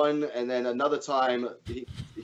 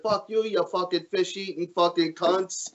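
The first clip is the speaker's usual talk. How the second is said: higher-pitched comic character cursing; mock-angry sketch voice, pitched up; Toll Booth Willie; 5 semitones higher than usual. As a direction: imitate an irate man swearing back. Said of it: "It had Toll Booth Willie".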